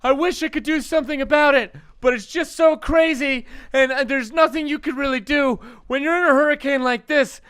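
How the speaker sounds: shouting over a hurricane, high and loud; hammed-up weatherman battling the wind; storm reporter. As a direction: yell it like a frantic live storm report. Mock hurricane reporter audition video.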